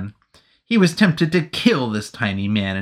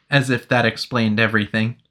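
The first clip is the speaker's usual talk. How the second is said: usual speaking voice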